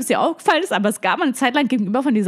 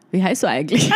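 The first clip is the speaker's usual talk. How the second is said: asks his name through a laugh; 3 semitones higher than usual. Retold or imitated. To the man at the bar, laughing. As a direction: giggle while you ask it.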